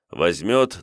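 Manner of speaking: ordinary delivery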